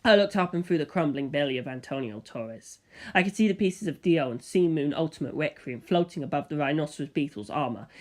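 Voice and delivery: plain reading voice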